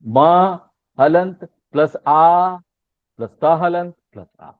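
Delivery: मा हलंत plus आ spelled out very slowly, part by part; walk the class through it syllable by syllable; patient teacher spelling out a word; slow demonstration. Shown as a demonstration. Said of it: "अब देखो".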